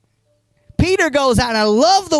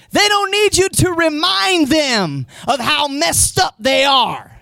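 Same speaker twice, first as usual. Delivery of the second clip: raised, slow, forceful sermon shout; full-force preaching; 6 semitones higher than usual. Acted. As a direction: drive it home to the congregation.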